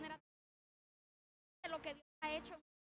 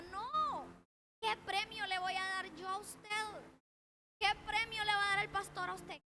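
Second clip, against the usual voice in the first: ordinary delivery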